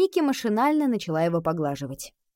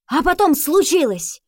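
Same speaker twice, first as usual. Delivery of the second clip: louder, higher dramatic build; dramatic reveal; 6 semitones higher, 6 dB louder than usual. A narrator's reading. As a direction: announce the big moment. Dramatic turning point.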